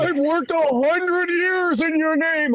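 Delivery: very high, slow put-on voice; stretched-out, high-pitched wail of a devotee; mock indignant plea. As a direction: plead your case in a hammed-up high voice. Acted plea to Jesus at gate.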